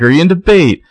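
high, loud imitated outburst; screamed question. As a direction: mock-scream the question at her. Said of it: "she just screams out".

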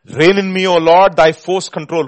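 loud, ringing preacher's prayer; impassioned plea. Real, not acted. Preacher praying for the Lord's control, impassioned plea. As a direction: proclaim each phrase forcefully. Repeats line at full force.

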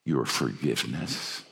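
a father begging his son's pardon, quietly; kneeling father asks his son's forgiveness, softly weeping; weeping apology. Retold or imitated. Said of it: "began to weep and said".

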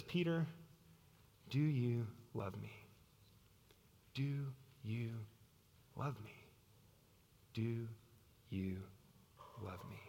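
soft, low, long pauses between words; hushed, searching plea; tender question. Acted. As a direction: ask gently, letting each word hang. Jesus gently questions Peter three times.

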